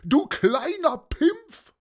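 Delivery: very high, loud mocking questions; incredulous jeering. A narrator's reading. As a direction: sneer loudly at a little kid. Same shouter jeers at boy.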